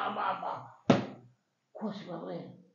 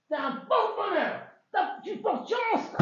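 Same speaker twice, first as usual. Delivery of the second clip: gushing flattery; 9 semitones higher than usual. Acted. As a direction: lay the flattery on thick. Laban flattering Yaakov loudly.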